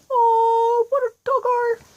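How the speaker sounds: high, cooing awe at a cute dog; squealing over an adorable doggo; swooning high voice. Retold or imitated. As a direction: coo it like you just saw a puppy.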